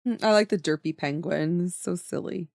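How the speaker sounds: normal talking voice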